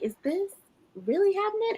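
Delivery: can't believe what she's reading; stunned disbelief. Retold or imitated. Reader reacting to the author's reveal, high stunned disbelief.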